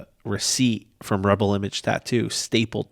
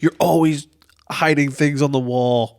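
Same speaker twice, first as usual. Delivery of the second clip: higher, louder parody of a nagging parent; mock scolding; 6 semitones higher, 5 dB louder than usual. Parent nagging a kid, higher mock scolding. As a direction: ham up the accusing parent.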